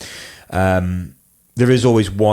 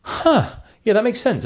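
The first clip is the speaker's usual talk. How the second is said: high-pitched imitated "huh" of dawning agreement; mock realization. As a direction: act out a client suddenly coming round. Acts clients being won over.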